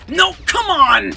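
high, loud protest; frustrated shout. Real, not acted. Player rushed by an enemy, frustrated yelling. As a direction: cry out against bad luck. Losing a fight in game.

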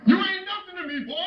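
high, loud outburst; furious disowning. Acted. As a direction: explode at your son. Father disowns son.

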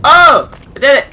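loud, high burst of joy; triumphant shout. Real, not acted. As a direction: cheer out the win. Game success, cheering.

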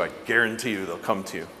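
normal talking voice

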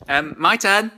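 loud, high, eager call; excited. Real, not acted. Excitedly claiming her turn.

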